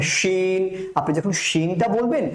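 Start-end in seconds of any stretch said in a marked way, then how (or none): 0.0-0.9 "শিন" with a full sh and long ee; mouth-filling SH. Shown as a demonstration. Teacher reviewing the letter, full-mouthed "শিন". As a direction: let the sh of "শিন" fill the mouth. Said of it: "মুখ ভর্তি করে আওয়াজ হবে।".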